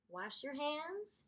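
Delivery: plain reading voice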